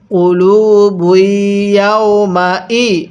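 slow, drawn-out Quran syllables; measured teaching chant; model recitation. Shown as a demonstration. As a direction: lead learners slowly through each syllable. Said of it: "আমার সাথে পড়ুন".

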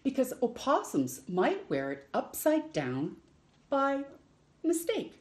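lively picture-book read-aloud with long pauses; reading to children. Acted. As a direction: read it like story time for little ones.

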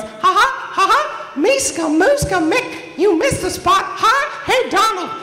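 very high, loud squeaky cartoon mouse with a giggle; Mickey Mouse. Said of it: "I walk out there like Mickey Mouse".

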